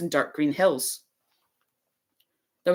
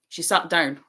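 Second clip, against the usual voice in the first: usual speaking voice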